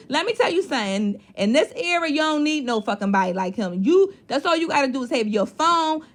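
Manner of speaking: ordinary talk, nothing marked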